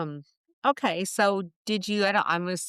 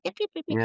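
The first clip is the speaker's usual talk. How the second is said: very high little dog yips; quick squeaky mock barking; imitated dog yapping; 13 semitones higher, much faster than usual. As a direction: yap like an angry little dog. Imitates the angry dogs yapping.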